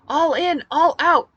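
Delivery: high, loud rallying cry; rally the crowd at full voice; shouted. Acted. She fires up the fighters in the garage, shouting. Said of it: "I shouted".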